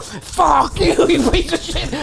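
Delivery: high, strained mock yell at a printer; cursing at the broken printer, high mock yell; mock rage at printer. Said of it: "he heard me go, "Fuck you".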